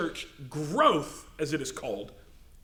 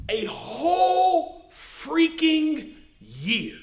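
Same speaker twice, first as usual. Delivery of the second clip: WHOLE and FREAKING drawn out long and high; emphatic disbelief; 6 semitones higher, much slower than usual. Real, not acted. Preacher on churchgoers never bringing anyone, drawn-out emphatic WHOLE FREAKING. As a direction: stretch out WHOLE and FREAKING, pitched up. Preacher hammering a shocking statistic.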